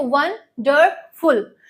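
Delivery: Wonderful slowly in three parts, "वन-डर-फुल"; broken into syllables. Shown as a demonstration. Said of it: "इसको जब break करेंगे हम syllable में".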